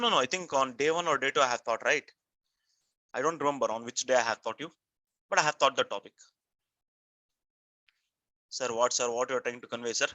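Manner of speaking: normal talking voice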